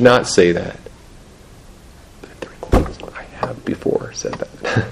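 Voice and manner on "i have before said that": a breathy whispered aside, almost to himself; whispered confession. Real, not acted. Pastor whispers a sheepish admission to the congregation. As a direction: let it slip out in a whisper, sheepish.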